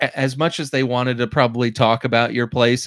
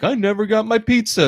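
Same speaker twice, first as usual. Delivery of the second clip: high, whiny-customer imitation; pitched-up put-on grievance; mock complaint; 9 semitones higher than usual. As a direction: play a customer claiming to be wronged. Voicing complaining customers who scam driver.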